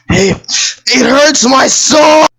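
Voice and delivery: anguished shout. Real, not acted. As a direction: cry out in mock pain.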